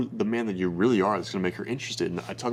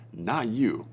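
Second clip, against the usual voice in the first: ordinary delivery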